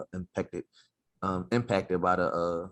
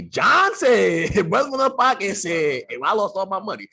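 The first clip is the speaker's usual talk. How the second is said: much higher, louder whiny complaint; angry investor; 11 semitones higher, 8 dB louder, slower than usual. Retold or imitated. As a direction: whine like a burned investor blaming the podcast. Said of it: "they can say".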